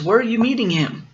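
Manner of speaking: quick, high storybook owl; high-voiced inquisitive creature; questioning owl. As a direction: ask it fast, pitched well up.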